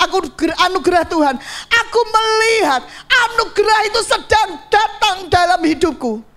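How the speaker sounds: bold faith declaration. Shown as a demonstration. Preacher showing the congregation how to speak out grace, bold declaration. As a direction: declare it aloud to God with conviction. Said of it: "Tapi mulai diperkatakan".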